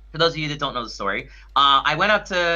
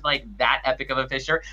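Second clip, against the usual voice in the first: ordinary delivery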